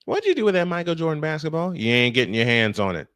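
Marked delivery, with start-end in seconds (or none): none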